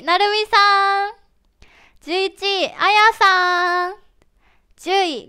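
slow, loud name call for each rank; ranking announcement. Acted. (配信者がランキングを発表する、ゆっくり大きな読み上げ)

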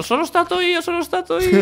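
high mocking voice of eager commenters. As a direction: squeak it like fans all claiming credit. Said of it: "Nei commenti adesso".

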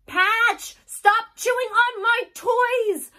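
loud, high shout at the dog; angry yell. Acted. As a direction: snap at the dog. Child yells at dog.

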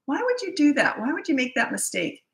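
high, incredulous boss grilling an employee; belittling boss. Acted. Boss berating an employee over a mistake, belittling and high. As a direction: sneer down at someone who slipped up. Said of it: "you're belittling them".

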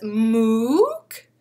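a lightly voiced moose bellow; a mock moose honk; moose call. Moose calling out to the herd, mock honk. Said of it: "trying to make his noise".

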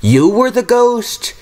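high, slow disbelieving question; astonished cry. A narrator's reading. Thomas finding out Oliver was the ghost all along. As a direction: stretch it out in amazement. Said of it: "cried Thomas".

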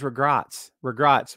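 "regrets" said as "re-GROTS"; misspelled regrets. Shown as a demonstration. Correcting a viewer's movie quote, "regrets" as "regrahts". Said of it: "R E G R A T S".

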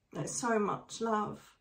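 ordinary delivery

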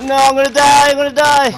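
high, loud panicked yell; panicked shout. Real, not acted. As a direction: scream it as you're about to die. Dying in a gunfight.

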